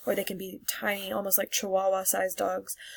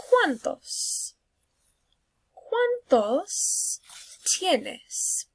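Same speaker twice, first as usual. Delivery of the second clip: high questioning teacher voice; 9 semitones higher, much slower than usual. Shown as a demonstration. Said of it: "teacher asks class".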